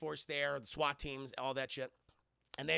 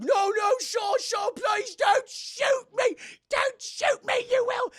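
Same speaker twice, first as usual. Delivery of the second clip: very high, loud terrified begging; hammed-up desperate plea; mock pleading; 12 dB louder, slower than usual. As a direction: beg for your life at the top of your voice. Henchman begs not to be shot.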